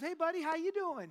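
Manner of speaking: high, singsong waitress greeting; talking to a toddler. Retold or imitated. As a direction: imitate a waitress cooing brightly at a little kid.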